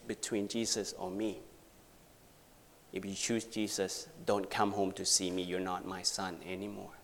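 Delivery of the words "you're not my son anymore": usual speaking voice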